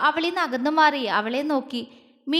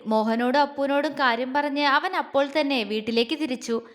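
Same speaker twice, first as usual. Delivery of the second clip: ordinary delivery, nothing marked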